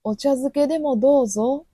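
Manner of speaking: sweet Kyoto offer. Retold or imitated. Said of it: "京都の人に".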